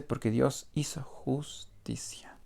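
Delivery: slow, hushed, reverent whisper; solemn whisper. Shown as a demonstration. Preacher hushing the true verse to the congregation, slow whisper.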